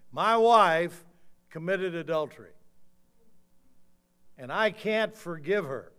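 imitating a husband's loud, high outburst; loud public accusation. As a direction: announce it to the whole church, pausing heavily. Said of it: "He said loud enough for everybody in church to hear him".

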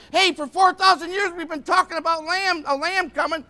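high, loud, eager outburst; excited announcement. Acted. John the Baptist announcing the lamb has come, excited. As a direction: announce it like long-awaited news. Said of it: "He was excited".

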